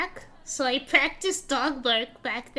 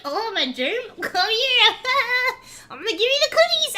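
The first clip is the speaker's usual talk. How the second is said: playful chase taunt, higher and louder, laughing; cute germ voice; 8 semitones higher, 6 dB louder than usual. Retold or imitated. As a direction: tease like a giggling germ. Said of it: "I would be like".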